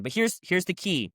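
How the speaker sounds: ordinary talk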